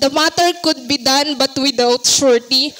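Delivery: usual speaking voice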